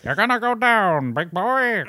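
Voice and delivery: put-on high, loud old-movie tough guy; forties gangster voice. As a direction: snarl it like a forties movie heavy. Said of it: "the nineteen forties voice".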